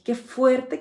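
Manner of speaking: shocked exclamation. Retold or imitated. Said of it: "cuando dije".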